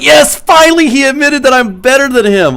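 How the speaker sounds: loud, high-pitched victory cry; exultant retold cheer, voice shooting up; triumphant. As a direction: crow it like a long-awaited win. Retold triumph at dad's admission.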